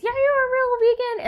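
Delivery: very high, squeaky caricature; mock them in a tiny high-pitched voice; ridiculing impression of a preachy vegan; mocking vegan zealot. Mimics the little vegan Nazis.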